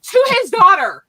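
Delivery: loud, high, laughing disbelief; laughing exclamation. Real, not acted. Teller stressing who grandpa said it to, laughing exclamation. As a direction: burst out with it through real laughter. Cracking up at own story.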